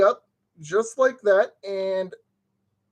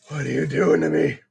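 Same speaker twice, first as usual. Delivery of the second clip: lower; 7 semitones lower than usual. Real, not acted. Pleading with the furniture piece.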